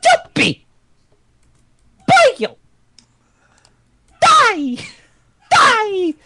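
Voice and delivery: very high, strained yelling; vocal-cord-shredding scream. Acted. Performer taking the last voice request, shredding high scream. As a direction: scream each short burst at the top of the range. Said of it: "destroyed [name]'s vocal cords".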